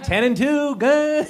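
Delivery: high, prim mock-proper driver. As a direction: play it squeaky-clean and high.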